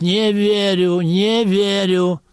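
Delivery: slow, drawn-out interrogator's disbelief; imitating a cop. Convict imitating cops at an interrogation, slow and mocking. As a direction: drawl it like a mocking investigator. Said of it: "Менты, говорю, то же самое повторяют".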